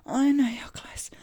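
high, loud, breathy whisper; breathless whisper. Acted. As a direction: gasp it out breathlessly. Whispered take amid moans.

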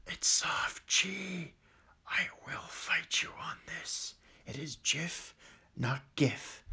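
hushed, deadly-serious insistence played for fun; mock-menacing whisper. Vlogger insists on soft G to viewers, mock-menacing whisper. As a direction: whisper it slowly, like a threat. Mock threat over how Jif is said.

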